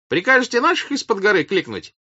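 high, loud question; high-voiced shouted offer; eager shout. A narrator's reading. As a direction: call it out to a commander. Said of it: "крикнул он".

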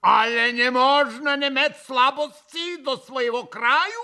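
loud, high, indignant Polish outburst; вскипев. A narrator's reading. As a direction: boil over in wounded national pride. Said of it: "Особенно вскипел пан Врублевский". (возмущённо, на повышенных тонах)

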